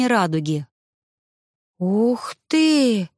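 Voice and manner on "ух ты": high, wowed exclamation; marvelling, pitched up; amazed. A narrator's reading. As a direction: let out an impressed wow. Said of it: "хором подали голоса подруги".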